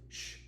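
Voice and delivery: very soft shush; low-volume, brief shh; hushing shh. A narrator's reading. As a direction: hush the child for sleep. Mother hushes her child.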